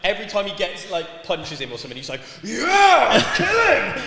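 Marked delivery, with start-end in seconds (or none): 2.4-4.1 high, loud laughing yell; half-laughed frantic cheer; mock shout. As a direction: scream it like the cornerman again. Cornerman yelling again.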